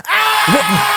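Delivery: very high-pitched, sudden scream; imitated scream. Passenger's head hits her thighs, imitated high scream. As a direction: shriek out an imitation of her panic. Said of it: "screaming her fucking lungs out".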